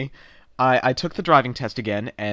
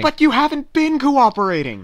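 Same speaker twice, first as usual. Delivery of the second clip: exasperated; 9 semitones higher than usual. Acted. Frustrated customer argues.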